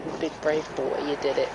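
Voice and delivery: high, sing-song soothing to a nervous horse; soothing baby talk. Retold or imitated. Rider soothing her nervous horse, high cooing voice. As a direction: coo it like reassuring a scared animal. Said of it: "just being like".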